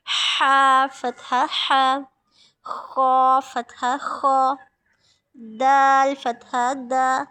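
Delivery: each letter and fatha drawn out slowly; slow chanted Arabic letter practice; slow letter drill. Shown as a demonstration. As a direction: recite each syllable long and clear like a teacher. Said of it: "teacher drills letters with fatha".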